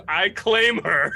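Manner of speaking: high, loud, slow proclamation through a laugh; mock claim. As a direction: lay claim to her grandly, half laughing. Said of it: "T J stands up and goes".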